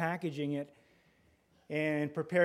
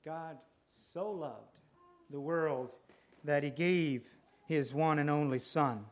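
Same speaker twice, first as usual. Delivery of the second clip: slow hushed emphasis; slower than usual. Acted.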